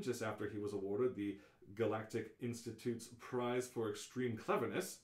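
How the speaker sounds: usual speaking voice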